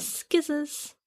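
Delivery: scissors said skizzers, hard k after s; skizzers pronunciation. Shown as a demonstration. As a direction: say Skizzers with a k sound. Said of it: "I non-ironically say skizzers".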